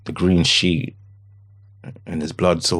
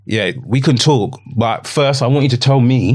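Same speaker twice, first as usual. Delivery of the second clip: rising, louder and firmer; insistent demand; 6 semitones higher, 4 dB louder than usual. Retold or imitated. Father to police officer, demanding loudly. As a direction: refuse to be put off.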